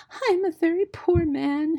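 high, loud mock-tearful muskrat voice; sobbing. A narrator's reading. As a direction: wail pitifully in a small creature's voice. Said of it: "he sobbed".

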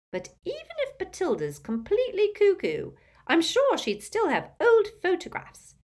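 put-on high, loud old woman; knowing, scheming old aunt; gossipy old aunt. Muriel voiced high.

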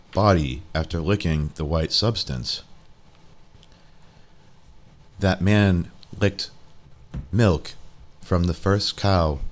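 calm, deliberate machine voice. Acted. The phone tells Jerry its findings, low and measured.

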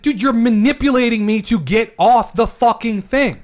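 loud, high-pitched exasperated outburst; heated rant. Real, not acted. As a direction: fire back at full heat, loud and high. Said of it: "your veins are gonna pop out".

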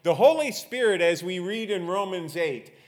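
preaching. Real, not acted. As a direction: drive each point home to the congregation, high and emphatic. Preaches with rising force.

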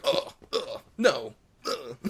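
short imitated hit grunts; punched video-game grunts. Mimicking video game voiceover when somebody gets punched, pained grunts. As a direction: grunt like a stock character taking punches. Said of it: "gets punched, like".

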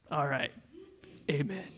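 muttered amen under the breath. Retold or imitated. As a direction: say it softly, just for yourself. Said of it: "under my breath".

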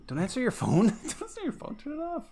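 high, loud nagging mom voice; mock scolding parent. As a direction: scold like a fussy old parent.

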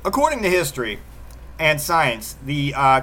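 normal talking voice

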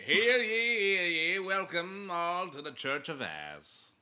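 hear ye, announce to the whole square; town crier. Pretend.

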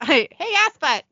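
acted shout. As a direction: call out the silly insult. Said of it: "and Dean shouts".

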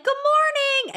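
very high, sing-out greeting; cheery teacher greeting. Retold or imitated. Teacher greeting a sobbing child at drop-off, mock cheery. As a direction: imitate an over-bright teacher at the car door.

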